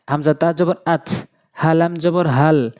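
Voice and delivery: usual speaking voice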